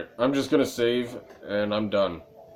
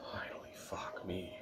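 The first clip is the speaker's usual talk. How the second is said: whispered, drained relief; exhausted whisper; 3 semitones lower, 19 dB quieter than usual. Real, not acted. Streamer whispering relief as the dialogue finally ends. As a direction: breathe it out, spent.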